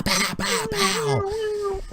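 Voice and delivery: rising, high imitated pow pow pow; blaster pow noises. Mimicking tie fighters breaking barrier.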